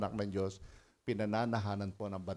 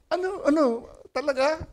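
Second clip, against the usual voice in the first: very high, loud "really?"; surprised; 9 dB louder than usual. Retold or imitated. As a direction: gasp of delighted disbelief. Excited disbelief on phone.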